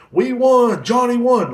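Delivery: high, loud mock celebration; shrill-sounding fan cheer, hammed up. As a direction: squeal it like a giddy fan. Mocking celebrating fans online.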